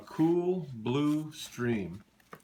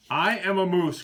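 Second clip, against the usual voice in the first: higher and louder cry; cried out; 6 semitones higher, 7 dB louder than usual. Acted. Morris cries out to the deer, loud protest. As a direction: protest it hard. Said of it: "cried Morris".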